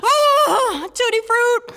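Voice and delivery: high, tearful strained cry; desperate substitute swearing. Acted. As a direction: force out silly words through acted anguish. Child fighting urge to curse.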